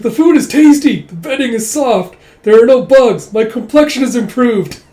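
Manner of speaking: very high, loud, gushing voice; mock pampered gush. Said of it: "It's like".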